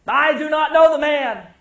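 high, loud insistent denial. Acted. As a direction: shout the denial, cornered. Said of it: "Three denials".

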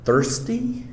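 "Thirsty?" asked high with a sharp rising pitch; puzzled question. Shown as a demonstration. Preacher wondering aloud to the class why thirst goes with bread, puzzled. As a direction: pick up "Thirsty?" as if it makes no sense. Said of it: "Well, that's interesting in connection with bread".

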